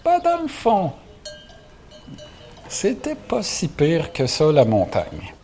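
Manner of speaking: high, slow reconsidering thought; slowly admitting it wasn't so bad; inner voice. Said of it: "Berger commence à se dire". (pensée lente, à contrecœur)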